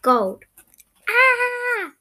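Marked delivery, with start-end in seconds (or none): 1.1-1.9 high, loud "Ah!" scream. A narrator's reading. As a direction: cry out in a high, drawn-out scream. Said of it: "I screamed".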